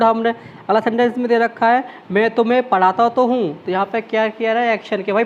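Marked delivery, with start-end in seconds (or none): none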